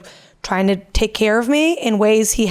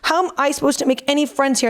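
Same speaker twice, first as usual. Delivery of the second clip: mock whiny, higher voice imitating him; whiny pouting; 5 semitones higher than usual. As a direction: mimic a sulking boyfriend complaining. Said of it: "Oh, he was pouting?".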